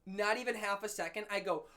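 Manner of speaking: normal talking voice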